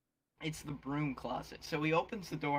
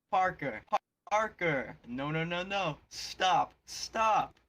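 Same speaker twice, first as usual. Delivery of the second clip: boss's raised, urgent call; 4 semitones higher, 5 dB louder, slower than usual. Acted. Boss stopping his late pizza driver, calling out. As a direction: yell to halt an employee, pitch jumping up. Acting the boss yelling for Parker.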